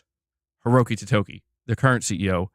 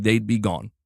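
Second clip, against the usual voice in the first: ordinary talk, nothing marked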